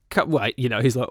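ordinary delivery

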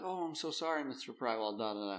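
soft rote sympathy, trailing down into filler; mock condolences. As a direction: imitate an agent murmuring routine condolences. Airline staff offering rote condolences.

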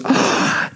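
big imitated child's gasp; mock gasp of realisation. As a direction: gasp as it suddenly dawns. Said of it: "Lulu goes".

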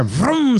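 high vocal vroom; drawn-out, high-pitched engine noise; engine revving imitation. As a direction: mimic a car roaring to life. Imitates the engine starting right up.

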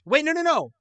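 high, loud panicked take-back; loud startled no no no; sudden alarm. Real, not acted. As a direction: stop everything, you just caught a mistake. Realizing answer is wrong.

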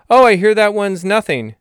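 hammed-up offhand sidekick; dismissive friend voice. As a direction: toss it off like a snooty pal. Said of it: "Salamander in the skit".

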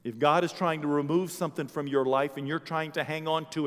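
loud, high, fervent sermon; rising revival preacher's cry; full-force preaching. Acted. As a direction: preach it out to the back row.

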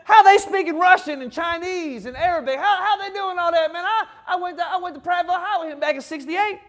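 loud amazed Alabama local; Alabama country folk. Retold or imitated. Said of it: "Be like if they were in Alabama".

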